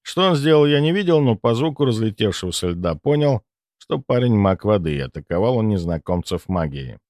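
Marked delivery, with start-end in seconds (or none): none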